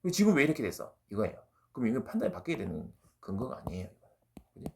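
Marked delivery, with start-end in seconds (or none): 0.0-0.9 high, loud mock accusation; punchy imitation of an angry challenge; resentful reproach. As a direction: act out soldiers blaming their general. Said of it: "원망을 하고 있어요".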